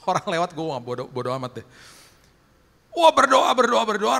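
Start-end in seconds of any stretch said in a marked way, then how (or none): 2.9-4.2 loud, high cry easing downward; exultant shout that gradually settles; fervent exclamation. Retold or imitated. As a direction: burst out in praying fervour. Re-enacting fervent roadside prayer.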